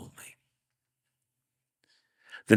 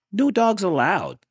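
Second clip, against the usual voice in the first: high, loud refusal; grumpy yell; 13 semitones higher, 7 dB louder than usual. Acted. Hank yelling at the coyote. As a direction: snap it at the coyote. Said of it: "Hank yelled".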